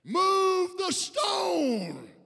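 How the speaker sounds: very slow, loud, high preacher cry; drawn-out shouted command. Acted. Preacher urging the congregation, long shouted cry. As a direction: stretch each word into a shout.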